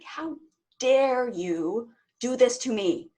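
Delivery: loud, slow furious demand; angry yell at God. Retold or imitated. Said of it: "I basically was yelling at him".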